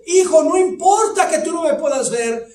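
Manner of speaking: high, loud impassioned shout; father shouting. Retold or imitated. Father shouts up to reassure his son, impassioned. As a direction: call up with fierce reassurance. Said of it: "Y el padre le dijo".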